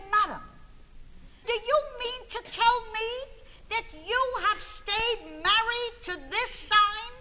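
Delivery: high, loud theatrical astonishment; appalled astrologer. Retold or imitated. Astrologer reacting to the husband's chart, loudly appalled. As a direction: play a shocked astrologer, hammering out the question.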